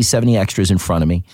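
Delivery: usual speaking voice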